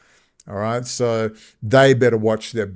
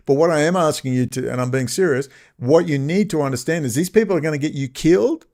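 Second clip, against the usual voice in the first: normal talking voice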